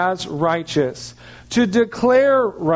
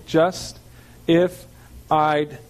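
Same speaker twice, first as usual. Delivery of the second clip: "just", "if", "I'd" as separate words with gaps between; slowly, part by part; much slower than usual. Shown as a demonstration. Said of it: "say it really slowly, part by part".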